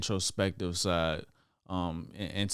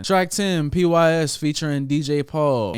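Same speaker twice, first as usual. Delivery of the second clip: excited announcing; 7 semitones higher, 8 dB louder than usual. Real, not acted. Announcing the next track.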